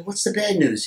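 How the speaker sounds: high, loud question; eager question. Acted. Friend asks ghost.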